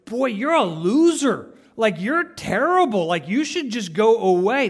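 taunting, scornful voice of the accuser; harsh accusing voice. Retold or imitated. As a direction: jeer at the listener like a bully. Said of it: "That is never the, the, the voice".

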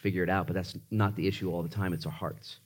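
low, soft, unhurried pastor's prayer; congregational prayer. Real, not acted. Pastor closing offering prayer with his church, soft and prayerful. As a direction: pray quietly, pausing between phrases.

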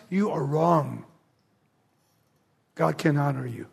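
ordinary talk

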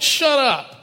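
high, snapped shut up; mock brush-off. Stranger brushing off the preacher, a high mock snap. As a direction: mimic the stranger's sharp rejection. Stranger rejects the pastor's message.

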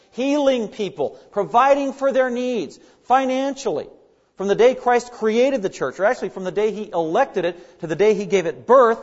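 fervent sermon climb; impassioned preaching. Acted. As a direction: press the point with rising fervor. Preacher builds on God's care.

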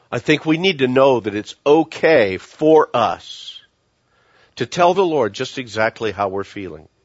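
ordinary talk

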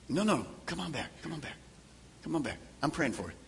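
soft, tender plea to come back; softly pleading with a drifting friend; loving coax. Acted. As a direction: coax them home gently. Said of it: "you lovingly come to them".

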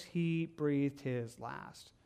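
soft, slow, grave reading; hushed and slow. Real, not acted. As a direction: let the words fade out. Reading Jesus' death slowly.

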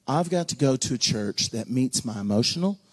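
ordinary talk, nothing marked

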